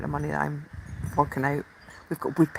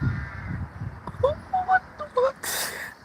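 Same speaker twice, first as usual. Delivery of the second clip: high giggle; 3 dB quieter than usual. Real, not acted. Giggling at the birds.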